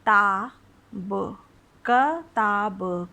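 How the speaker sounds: slow syllable-by-syllable reading of क ता ब; sounded out letter by letter. Shown as a demonstration. Teacher sounding out letters for kids, slowly. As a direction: spell out क ता ब slowly, one syllable at a time. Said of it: "जैसे ये लिखा हुआ है क".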